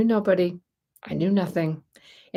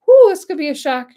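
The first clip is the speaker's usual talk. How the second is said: excited whoop; 10 semitones higher, 10 dB louder than usual. Real, not acted. Reacting to a viewer moving from Texas.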